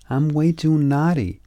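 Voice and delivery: little frog's voice. A narrator's reading. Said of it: "said Little Frog".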